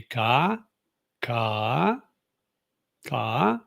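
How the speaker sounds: "ka" dipping down, then rising up; "ka" down then up, like the Thai tone; "ka" with a dip and a rise; falling-rising tone. Shown as a demonstration. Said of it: "where it goes down, then up".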